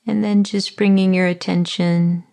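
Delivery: calm body-scan voice with long pauses; guided meditation. Acted. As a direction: ease the listener into their body, unhurried.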